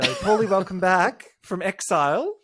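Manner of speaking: hammed-up welcome back; mock announcer. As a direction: announce it like a show host. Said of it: "and then go".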